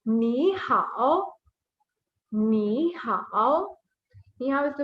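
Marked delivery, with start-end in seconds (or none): 0.1-1.3 slow ni hao, with hao dipping and rising; ni hao in careful, drawn-out Mandarin tones; read with the tones. Shown as a demonstration. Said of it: "we read it with the tone". 2.3-3.8 slow ni hao, with hao dipping and rising; ni hao in careful, drawn-out Mandarin tones; read with the tones. Shown as a demonstration. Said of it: "we read it with the tone".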